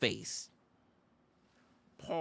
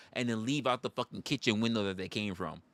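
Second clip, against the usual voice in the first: normal talking voice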